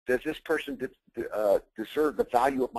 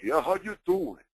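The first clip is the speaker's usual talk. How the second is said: slow, drawn-out New York "how you doing"; imitating a New York restaurant owner greeting; New York accent; 5 semitones higher, 3 dB louder than usual. Retelling the Vegas owner, New York accent. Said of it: "He was from New York".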